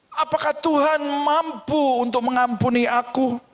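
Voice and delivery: high, anguished pleading; troubled youth asking God in a high voice; pleading young man. Acted. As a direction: beg to be forgiven. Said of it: "anak muda yang terus bertanya".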